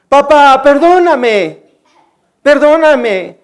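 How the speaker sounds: pleading daughter. Retold or imitated. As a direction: plead like a child who keeps coming back, stretching each word. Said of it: "Vuelve a mi cuarto y dice". (imitación de hija suplicante, aguda y alargada)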